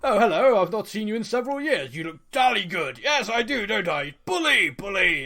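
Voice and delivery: mock posh British accent, teasing a cliché. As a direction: play a jolly stiff-upper-lip English toff. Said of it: "the Brits are like".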